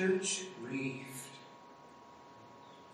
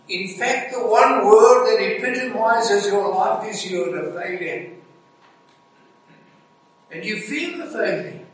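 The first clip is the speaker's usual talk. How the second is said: higher and much louder, driving the charge home; preaching at full force; 5 semitones higher, 10 dB louder than usual. Acted. Preacher pressing the congregation about failure, in a loud fervent preaching voice. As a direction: build to a fervent, ringing climax.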